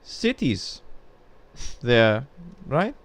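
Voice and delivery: put-on slow, high German accent; lightly put-on German accent. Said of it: "he speaks in a German accent".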